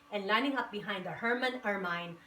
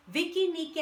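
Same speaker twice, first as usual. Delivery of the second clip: usual speaking voice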